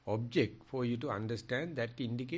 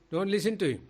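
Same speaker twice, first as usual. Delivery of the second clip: much louder, the peak of the warning; forceful retold command; 6 semitones higher, 8 dB louder, faster than usual. Sariputta's warning about a harsh speaker, at full force. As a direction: hammer don't listen to him home. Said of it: "Okay, he says".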